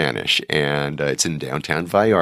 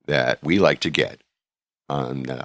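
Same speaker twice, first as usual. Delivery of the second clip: normal talking voice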